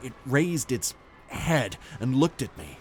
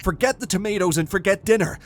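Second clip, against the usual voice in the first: raised, louder, insistent voice; urgent interruption; 8 semitones higher, 5 dB louder, faster than usual. A narrator's reading. Husband cutting off wife's dinner chatter, urgent. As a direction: cut in hard and urgent.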